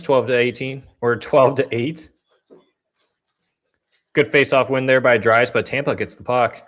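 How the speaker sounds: live game call, even and steady; casual hockey commentary; play-by-play. Acted. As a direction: call the puck as it moves.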